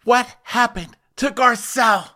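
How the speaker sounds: high-pitched mock heartbreak; Harry Hamlin, sad. Sad Harry Hamlin asking about Garcelle, mock heartbroken. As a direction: ask it in a high, overdone lament. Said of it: "Harry Hamlin was so sad".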